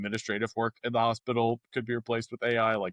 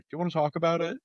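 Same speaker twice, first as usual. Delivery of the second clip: gentle counselor offer; 6 semitones higher, much faster than usual. Retold or imitated. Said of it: "be like".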